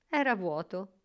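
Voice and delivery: ordinary delivery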